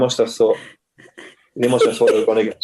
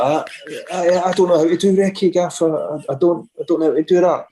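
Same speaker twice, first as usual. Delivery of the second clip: higher, stammering protest; flustered imitation; 5 semitones higher than usual. Masseur answering the gaffer's odd request, flustered and higher. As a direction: imitate an awkward, caught-out staffer.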